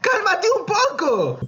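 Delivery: high, slow mock plea to calm down; stretched-out, high-pitched "calm down"; mock exasperated plea. Telling troll to calm down.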